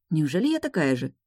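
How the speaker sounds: ordinary delivery, nothing marked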